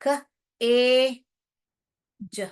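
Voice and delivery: slow letter-by-letter sounding out; spelling out sounds. Shown as a demonstration. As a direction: sound out ख, ए, ज one at a time. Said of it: "आपको example से समझाऊंगी".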